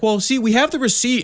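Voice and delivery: a touch of Emily explaining sweetly; imitating Emily. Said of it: "she's like".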